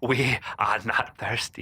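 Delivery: low, sinister villain voice; hammed-up menacing villain line; evil villain voice. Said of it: "he was bringing that evil tote voice".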